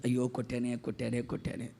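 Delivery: low, very soft lament; imitated remorseful father. Father alone after hitting his child, imitated hushed lament. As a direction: murmur it over and over in regret. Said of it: "కూర్చొని ఏడుస్తుంటారు".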